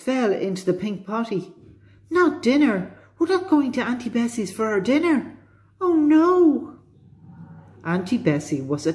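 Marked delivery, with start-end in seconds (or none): 2.1-6.8 high-pitched acted dismay, building to a wail; crying out. A narrator's reading. Danny dreading Auntie Bessie's cooking, crying out in dismay. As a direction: protest high, ending in a loud storybook cry. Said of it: "cried Danny".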